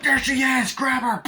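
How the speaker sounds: high, loud urgent command; acted alarm shout. Hunters spotting the fleeing bride, acted alarm shout. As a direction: sound the hue and cry.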